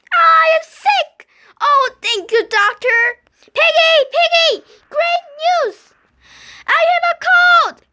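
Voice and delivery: loud, high happy shout; bursting with happy news; overjoyed. Acted. Joyful news.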